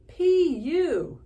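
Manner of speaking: high, loud grossed-out squeal; disgusted. A narrator's reading. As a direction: recoil from the stink. Stepmother disgusted by smelly sneaker.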